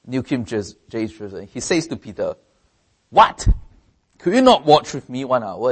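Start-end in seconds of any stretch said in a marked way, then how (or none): 3.1-5.7 sharp loud What, then rebuke; exclaimed rebuke. Shown as a demonstration. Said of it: "With an exclamation mark".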